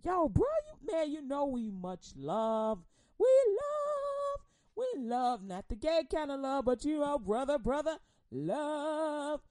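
a hint of a street bro, with a sung "we love"; casual bro voice, singsong on love; Peter's reply. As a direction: answer like a buddy, lightly. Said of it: "He like".

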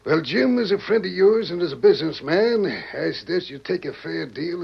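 high, loud, falsely friendly pitch; oily persuasion. Acted. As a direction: sell it like a trusted friend. Smooth banker pushes sale.

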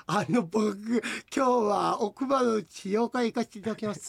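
slow, slurred dentist in the co-host's manner; mock co-host impression. Host teasing his co-host as a lookalike dentist, mock slurred impression. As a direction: mimic a mush-mouthed dentist, slowly. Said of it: "そんな喋り方しないでしょ".

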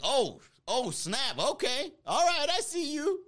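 impressed saleslady. Retold or imitated.

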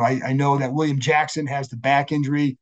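ordinary talk, nothing marked

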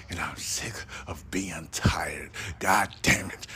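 weary whisper. Real, not acted. Podcaster to listeners, a drained whisper. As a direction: drop to a tired whisper.

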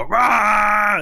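shouted rah; monster war cry. Acted. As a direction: roar as the bugbears charge.

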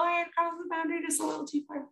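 high, squeaky confession; sheepish admission. Real, not acted. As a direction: pitch it up high, owning up to overstepping. Teller admits overstepping, comic squeal.

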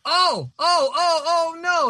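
high, loud oh oh oh no; stunned and thrilled at the news; excited disbelief. Real, not acted. Reacting to who created the hero, high and loud excitement. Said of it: "reacts to the creators' names".

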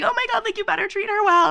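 high, excited mock gush. Friend retelling her tipsy gush to a stranger, high and excited. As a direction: squeal it at him. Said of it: "And I was like".

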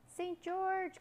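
high, drawn-out call. Acted. Boy searching for Saint George, high carrying call. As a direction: send the name across a clearing, pitched high. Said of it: "called the boy".

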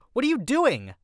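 high, loud indignant shout; yelled. A narrator's reading. Wife yelling at husband paying the parking meter, yelled. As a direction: yell it back at someone in disbelief. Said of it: "My wife turned back and yelled".

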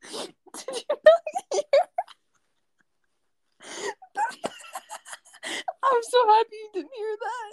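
very high, teary squeal through real laughter; laughing till crying. Real, not acted. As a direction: squeak it out while cry-laughing. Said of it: "are you already crying".

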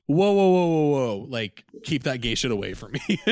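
high, loud mock alarm, backing away; hammed-up disgusted friend pushing away; mock recoiling guy. Friend recoiling from a confession, hammed-up panicked whoa. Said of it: "It felt very judgy".

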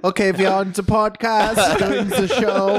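high, slow mock German accent; camp German accent. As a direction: draw out a hammed-up German accent. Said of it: "German accents are so fun to do".

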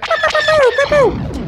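high, playful pew pew lasers; childlike zap noises, very high; laser sound effects. Retold or imitated. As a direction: make the laser blasts like a kid with toys. Kid playing with toy ships.

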